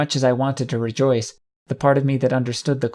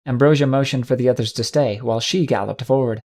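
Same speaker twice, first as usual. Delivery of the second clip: ordinary delivery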